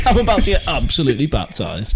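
amused repeat through laughter; laughing. Real, not acted. Friend echoing the baptism joke, laughing.

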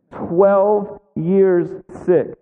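drawn out, each word weighted; slow it right down on twelve years sick; slow emphasis. Real, not acted. Preacher to congregation, drawn out and weighted. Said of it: "slow emphatic sermon line".

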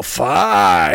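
puppet dragon roaring fire; mock dragon blast; dragon yell. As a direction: bark it like a cartoon dragon. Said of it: "Dirty Dragon would yell".

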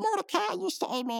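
mock damsel. Damsel thanks hero.

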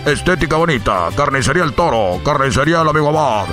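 low, fast sponsor rundown; sponsor list read. Acted. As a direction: rattle off the sponsors deep and quick.

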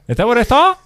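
surprised. Real, not acted. Amused disbelief after laughing.

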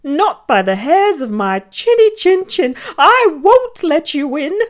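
a small pig shouting through the door; frightened little pig. A narrator's reading. Little pig calls out to the wolf, high and squeaky.

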